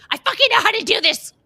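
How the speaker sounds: loud, high, fast mock tirade; exasperated outburst. Voicing the suppressed urge at Sharon, exasperated outburst. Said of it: "would so have to suppress the".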